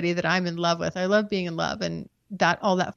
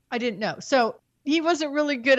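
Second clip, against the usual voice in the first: ordinary delivery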